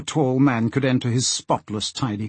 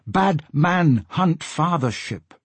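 slow, higher-pitched pidgin; lightly voiced porpoise, words drawn out; porpoise speech; 4 semitones higher, slower than usual. Porpoise warns Asta of a bad man, slow porpoise speech.

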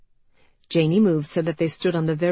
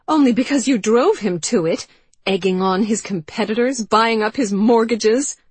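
loud, higher, venomous; 6 semitones higher, 7 dB louder than usual. Acted. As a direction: spit out every word. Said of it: "The venom in Anne's voice".

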